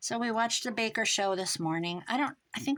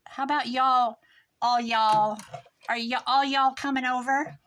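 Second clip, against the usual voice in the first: mocking y'all; 4 semitones higher, 7 dB louder than usual. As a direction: parody people who fake "all y'all". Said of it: "I hate that".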